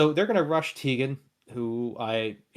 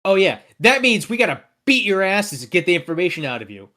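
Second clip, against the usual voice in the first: higher, emphatic thug threat; Grim Greg; 6 semitones higher, 4 dB louder than usual. Acted. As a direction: threaten them like a hot-headed henchman. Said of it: "and he's like".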